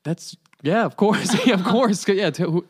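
high, loud, falling over yourself to agree; eager agreement. Retold or imitated. Family accepts the yacht invitation, high and overeager. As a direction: imitate a flustered, overeager yes.

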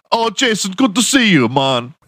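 put-on high, friendly Jamaican-accented greeting; mock Jamaican accent. Villager greeting Jason in a mock Jamaican accent. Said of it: "They have Jamaican accents".

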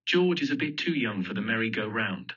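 usual speaking voice

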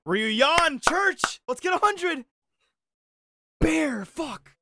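high, drawn-out hype shout; excited shout. Real, not acted. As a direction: hype up the round. Shouting during a tense round.

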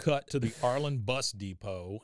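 ordinary delivery

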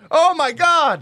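loud, thrilled exclamation; excited shout. Real, not acted. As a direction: cheer as the dice land. Dice roll success.

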